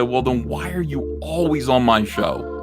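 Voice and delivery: ordinary talk, nothing marked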